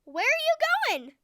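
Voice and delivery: cheerful eel Emerson greeting the nervous whale. Acted. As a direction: bounce in as a chirpy eel. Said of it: "a cheerful eel named Emerson".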